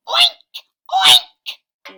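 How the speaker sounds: very loud, high squeal of oink; pig oinking. Acted. Animal noises in read story.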